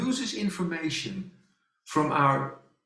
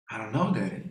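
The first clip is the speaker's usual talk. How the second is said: put-on lower, softer little girl; daughter's weak voice; 5 semitones lower, 4 dB quieter, faster than usual. Father imitating his daughter's weak reply, lower and softer. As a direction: mimic her faint reply.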